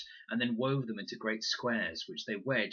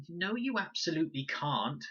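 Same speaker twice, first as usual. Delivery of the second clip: high, sharp little protest; indignant; 8 semitones higher than usual. A narrator's reading. Max objecting to Fred, high and indignant. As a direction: correct him with outrage. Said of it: "said Max indignantly".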